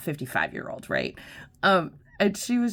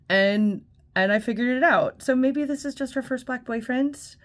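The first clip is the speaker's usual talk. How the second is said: ordinary talk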